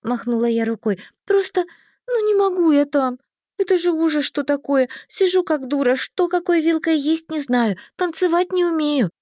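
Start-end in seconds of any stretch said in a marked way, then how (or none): 1.2-9.1 high-pitched fretful rush of excuses; distressed complaint. A narrator's reading. Heroine explains why receptions horrify her, whining. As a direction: pour out grievances, pitched up.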